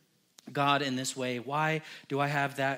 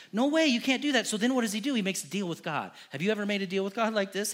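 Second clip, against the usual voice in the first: ordinary delivery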